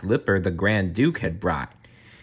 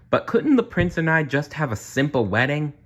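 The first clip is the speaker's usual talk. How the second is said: a touch higher, gentle pleading; lightly voiced Cinderella; 7 semitones higher than usual. A narrator's reading. Cinderella gently asking Prudence for a simple wedding, lightly voiced. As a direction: ask sweetly for something simpler.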